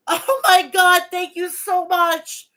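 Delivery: high, loud, overwhelmed gratitude; moved to tears. Real, not acted. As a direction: burst into real, drawn-out thanks with a laugh. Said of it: "you're gonna get me to cry today".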